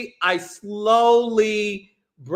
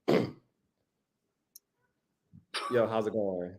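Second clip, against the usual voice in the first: much lower, quieter bro voice; deep masculine voice; 13 semitones lower, 12 dB quieter than usual. Shown as a demonstration. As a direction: drop into a deep macho bro voice. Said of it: "I'm gonna tell you what I do".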